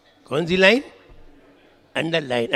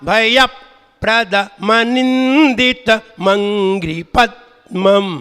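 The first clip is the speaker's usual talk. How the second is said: slow, drawn-out recited Sanskrit verse; slow verse recitation; 4 semitones higher, much slower than usual. Acted. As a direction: recite the verse line syllable by syllable.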